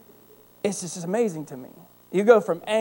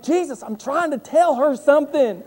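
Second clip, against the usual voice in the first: higher, louder complaint to Jesus; exasperated plea; 9 semitones higher, 6 dB louder than usual. Retold or imitated. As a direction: protest to heaven in mock outrage. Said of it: "I'm like".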